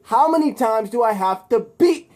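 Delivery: Spider-Man mocks Electro mid-fight; taunting. A narrator's reading. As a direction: tease the villain. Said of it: "Spider-Man says".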